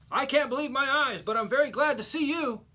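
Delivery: put-on high, loud old lady voice, astonished and grateful; rescued old lady's relieved exclamation; amazed old lady. A narrator's reading.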